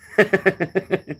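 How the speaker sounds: high, real laugh breaking out; burst of laughter. Real, not acted. Laughing at own story.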